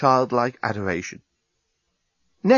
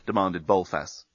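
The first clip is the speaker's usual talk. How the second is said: plain reading voice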